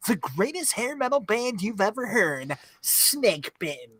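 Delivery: usual speaking voice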